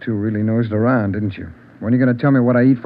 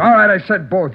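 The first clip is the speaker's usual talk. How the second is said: angry concession; 7 semitones higher, 5 dB louder than usual. Acted. Suspect giving in angrily.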